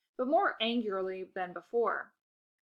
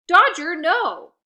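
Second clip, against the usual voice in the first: loud, higher sharp command; shouting at dog; 9 semitones higher, 14 dB louder, much slower than usual. Acted. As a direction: stop the dog attacking. Owner yelling at dog.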